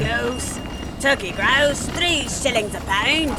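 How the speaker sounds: a poulterer crying his grouse prices; poulterer's cry. Acted. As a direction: call the price over the crowd.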